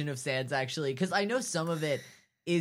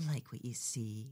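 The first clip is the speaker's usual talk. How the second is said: soft, lower flirtatious purr; 5 semitones lower, 10 dB quieter than usual. Acted. Mary Sue flirts close at his neck, purring. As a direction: purr it quietly, teasingly. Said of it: "She purrs".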